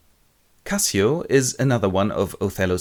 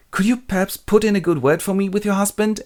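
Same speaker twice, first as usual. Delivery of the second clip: high-pitched pleading; hammed-up wheedling; timid request; 12 semitones higher, 4 dB louder than usual. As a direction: ask a favour meekly, pitched way up. Cassio begs a favour.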